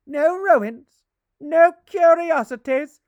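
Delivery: loud, high, indignant echo; indignant outburst. Acted. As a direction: throw the words back, outraged. Ghost indignant at the insult.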